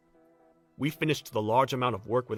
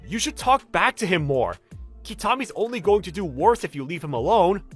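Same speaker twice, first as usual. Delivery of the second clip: higher, emphatic urging; indignant urging; 4 semitones higher, 5 dB louder than usual. Acted. Colleague urging a coworker to talk back, indignant. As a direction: push them to stand up for themselves. Colleague urges pushback in story.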